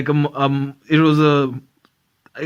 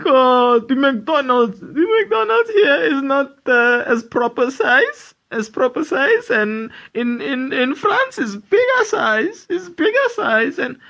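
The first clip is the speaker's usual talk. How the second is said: put-on French-accented voice, much higher and slow; mock French woman complaining, much higher; French tourist; 8 semitones higher, slower than usual. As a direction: do the French lady, high and indignant. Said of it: "She's like".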